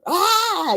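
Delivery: high frustrated "aah"; mock wail of frustration, pitched high; frustrated cry. Recalling being fed up with God's silence, frustrated cry. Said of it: "you know, frustrated".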